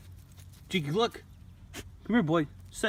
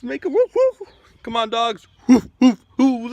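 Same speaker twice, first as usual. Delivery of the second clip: mock dog barks; 7 semitones higher, 8 dB louder than usual. Urging dogs to bark, barking himself.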